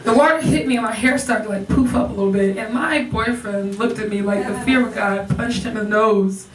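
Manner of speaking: normal talking voice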